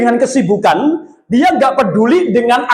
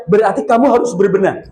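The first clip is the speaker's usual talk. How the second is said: normal talking voice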